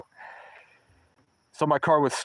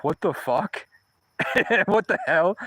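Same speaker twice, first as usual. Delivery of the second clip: startled dad; 5 semitones higher than usual. Retold or imitated. Dad reacts to snow offer.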